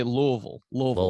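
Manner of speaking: Louisville slurred into two syllables, "LOO-vul"; Louisville as "LUH-vul", no "ee"; locals' pronunciation. Shown as a demonstration. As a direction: swallow the middle and end of Louisville, like a local. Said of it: "They say, they say".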